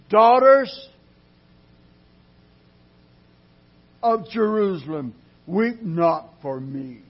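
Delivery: thundering Jesus addressing the daughters; ringing call of Jesus. Acted. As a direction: cry out to the women as Jesus. Jesus voiced in sermon.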